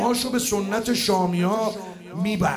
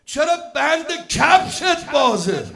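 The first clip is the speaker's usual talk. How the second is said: higher, louder mocking taunt; Qasim mocking Azraq; 7 semitones higher, 7 dB louder than usual. Qasim taunting Azraq in a high, loud mocking voice. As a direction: taunt him like a young warrior scorning a giant. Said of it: "فرمود".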